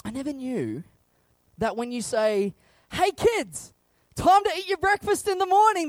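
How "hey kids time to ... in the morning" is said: a high, louder dad call; calling the kids. Retold or imitated. Dad calling his kids to breakfast, higher and louder.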